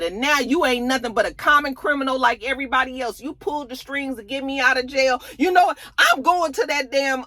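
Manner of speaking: imitating an upset man cutting someone off. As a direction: lay into him as a retold, wounded telling-off. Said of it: "But Theo was upset about it".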